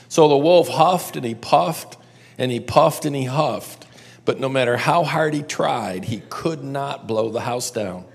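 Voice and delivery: lively read-aloud for a group, lingering on the big bad wolf; storytelling narration. A narrator's reading. Teacher reading the three little pigs to the class, lively storytelling. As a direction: tell it like a bedtime tale to a room of kids.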